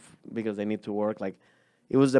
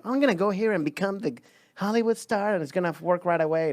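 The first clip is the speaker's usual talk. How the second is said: high, rushed dreamer's voice; mock starry-eyed; 8 semitones higher, much faster than usual. Comedian mimicking a naive Hollywood hopeful, starry-eyed. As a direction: play the wide-eyed hopeful, pitched up and quick.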